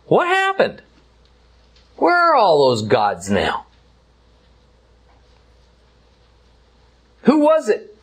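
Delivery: high-pitched mocking questions, as God taunting; sarcastic divine taunt, pitched well up. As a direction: needle them with mock-innocent, high-pitched questions. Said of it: "kind of sarcastically".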